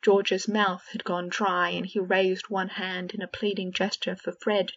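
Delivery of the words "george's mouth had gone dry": ordinary delivery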